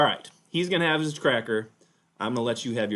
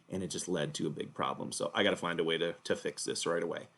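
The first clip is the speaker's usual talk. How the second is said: lower, softer aside voice; 7 semitones lower, 5 dB quieter than usual. Acted. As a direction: murmur it aside to the audience. Host steps aside, time out.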